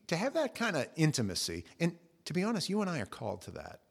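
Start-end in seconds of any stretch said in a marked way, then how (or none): none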